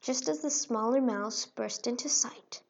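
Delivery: usual speaking voice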